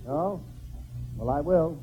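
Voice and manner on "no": ordinary talk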